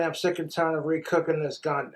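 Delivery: ordinary delivery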